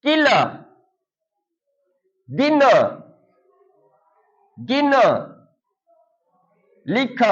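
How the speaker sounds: high, carefully spaced call-out words; word list drill. Shown as a demonstration. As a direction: read each word clearly for children to repeat. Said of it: "अब आप सभी लोग हमारे साथ साथ इसे बोलेंगे".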